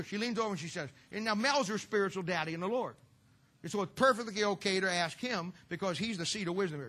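ordinary delivery

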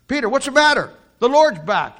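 high and loud, urging; excited friend. Acted. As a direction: shake him out of it. Friend urges Peter excitedly.

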